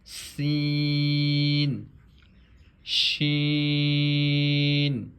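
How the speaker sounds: slow, drawn-out letter drill; measured Arabic letter recitation; Qaida recitation. Shown as a demonstration. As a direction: recite each letter clearly like a Quran teacher.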